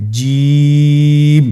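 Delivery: জিম held long and loud; drawn-out Jim. Shown as a demonstration. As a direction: stretch জিম with full voice. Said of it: "আপনারা আমার সাথে পড়ুন". (তিন আলিফ টেনে জিম)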